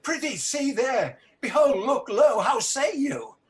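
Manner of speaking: high, frantic pleading at a vision; horrified. Acted. Macbeth begs his wife to look at the ghost, horrified. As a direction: point at the ghost in horror.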